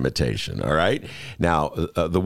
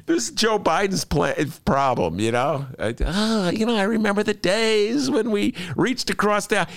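mocking. Mocking Biden's nostalgia, ranting.

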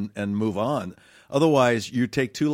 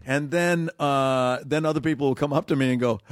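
ordinary delivery

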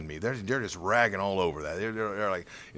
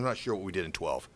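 ordinary talk, nothing marked

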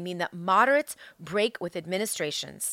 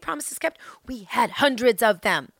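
usual speaking voice

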